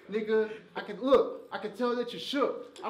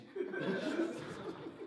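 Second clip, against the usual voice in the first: airy laugh; 8 semitones higher, 4 dB quieter than usual. Real, not acted. Laugh after kitchen line.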